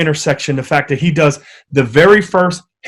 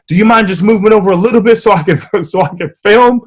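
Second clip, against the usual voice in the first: laughing disbelief; 5 semitones higher, faster than usual. Retold or imitated. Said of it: "I just told this young man".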